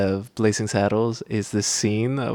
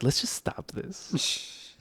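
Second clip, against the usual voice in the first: slow, breathy near-whisper; soft, unvoiced aside to friends; hushed plea; 5 semitones higher, 10 dB quieter, slower than usual. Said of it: "were just like".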